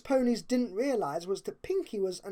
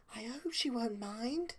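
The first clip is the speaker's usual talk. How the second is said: softer, high and gentle; Fluttershy's voice; 3 semitones higher, 8 dB quieter than usual. A narrator's reading. Fluttershy softly praising Rarity's plan. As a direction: speak it timidly, eager to please.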